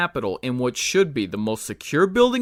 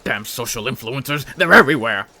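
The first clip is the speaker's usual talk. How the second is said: mock outrage; 4 dB louder than usual.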